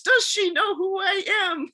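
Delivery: high, loud outraged yell, drawn out; retold yelling. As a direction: yell it slowly like an indignant big shot. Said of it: "the yelling I heard was".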